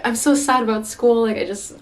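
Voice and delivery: high whiny complaint. Retold or imitated. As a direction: whine it like a sulky student. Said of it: "because I'm like".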